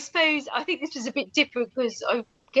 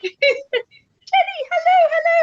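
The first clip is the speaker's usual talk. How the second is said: imitating a cheery greeting; 6 dB louder, slower than usual. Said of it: "He was like".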